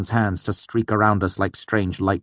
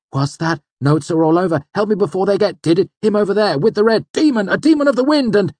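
high, loud overlapping alarm; frantic bystanders' shouts, climbing to a shriek; panicked crowd chatter; 7 dB louder, faster than usual. A narrator's reading. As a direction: cry out in rising panic. Panicked crowd all talking at once.

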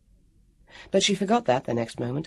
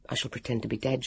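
low, hushed inner voice; secretive undertone, lower and soft; private thought; 4 semitones lower, 9 dB quieter, faster than usual. A narrator's reading. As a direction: think it to yourself, softly. Heroine's secret thought, lying still.

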